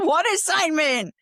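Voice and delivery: laughing through the words, high. Real, not acted. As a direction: say it mid-laugh, pitch jumping up. Repeats phrase while laughing.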